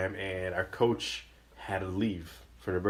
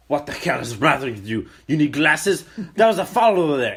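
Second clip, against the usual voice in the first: loud heckle building higher; yelling at the ref; 4 semitones higher, 8 dB louder than usual. Retold or imitated. Dad disputing a bad call, yelling at ref. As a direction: start firm and build to a shout. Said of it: "My dad starts yelling at the ref".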